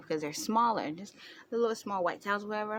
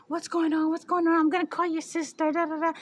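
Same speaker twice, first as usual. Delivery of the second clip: high, loud mock fluster; frantic mom; 10 semitones higher, 5 dB louder than usual. Mom frets about calling the nurse sister. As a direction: rattle it off like a frazzled mom.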